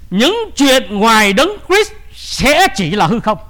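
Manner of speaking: slow, weighty preaching declaration; emphatic proclamation. Real, not acted. Preacher declaring a truth to the congregation, slow and emphatic. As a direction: proclaim it slowly, drawing out the words.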